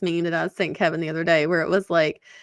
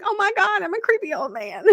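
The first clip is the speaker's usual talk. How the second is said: much higher, laughing self-mockery; mock horror; 12 semitones higher than usual. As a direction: realise you sound creepy, amused. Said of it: "When you're like".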